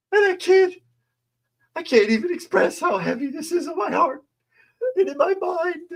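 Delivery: very high, quivering parody of tearful speech; mocking a weepy councilwoman in a very high voice; mock crying. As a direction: ham up a weepy plea. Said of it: "I should have done that in an accent".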